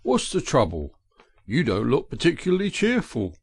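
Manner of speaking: a kindly train driver asking after her; voiced engine driver, concerned; gruff engine driver. A narrator's reading.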